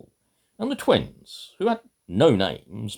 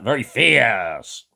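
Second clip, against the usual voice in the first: "Very fierce" said louder and slowly, with weight on "fierce"; mock fierce; 7 dB louder, slower than usual. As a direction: pretend to be fierce, half-playful. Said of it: "do you ever have times when you're like that".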